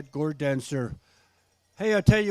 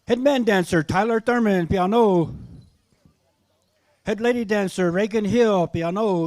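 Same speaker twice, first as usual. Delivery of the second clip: loud raised announcer call; powwow emcee; 5 semitones higher, 8 dB louder than usual. Acted. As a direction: call the names out to the crowd. Emcee calls dancers' names.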